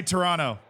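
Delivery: Toronto without the second T; Toronto run together as "Toronno"; local pronunciation. Shown as a demonstration. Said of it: "You just skip the T".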